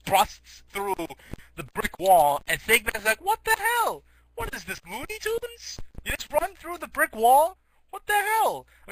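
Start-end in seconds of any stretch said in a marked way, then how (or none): 3.2-8.7 very high-pitched cartoon outrage; squeaky mock disbelief. Sheriff watching his prisoner bust through the wall, squeaky disbelief.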